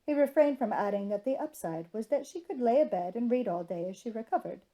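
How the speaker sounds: plain reading voice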